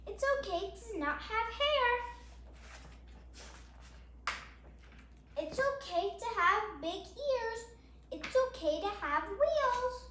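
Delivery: steady, gentle picture-book reading; reading to children. Acted. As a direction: read each page out to little listeners. Reads picture book aloud.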